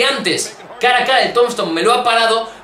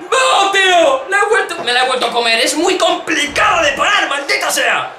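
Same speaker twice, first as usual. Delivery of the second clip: frustrated shout; 5 semitones higher than usual. Real, not acted. Loses to the opponent's finisher.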